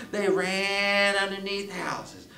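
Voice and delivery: high, loud chase narration; excited story narration. A narrator's reading. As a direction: tell the chase at full pitch, stretching key words.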